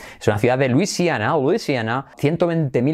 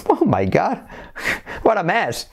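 ordinary talk, nothing marked

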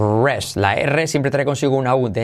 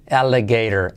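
usual speaking voice

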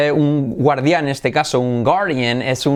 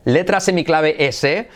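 ordinary talk

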